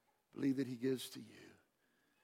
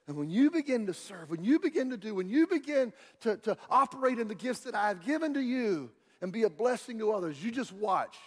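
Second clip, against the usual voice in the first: loud, higher, insistent build; forceful preaching; 7 semitones higher, 7 dB louder than usual. Real, not acted. Preacher urges the church to begin serving, loud and insistent. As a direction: drive each repeated phrase home.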